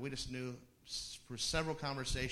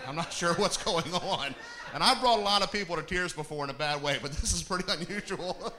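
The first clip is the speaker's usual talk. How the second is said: louder, higher, laughing through the words; can barely get it out for laughing; laughing while talking; 5 semitones higher, 12 dB louder than usual. Real, not acted. To the congregation, recalling tears, laughing through it.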